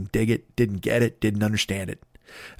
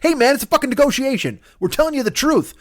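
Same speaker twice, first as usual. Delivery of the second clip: much higher, pushy mock outrage; heated shark haggling; 13 semitones higher than usual. Shark arguing with the whales over dudes, in high mock outrage. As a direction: squeal it like a shark bargaining hard.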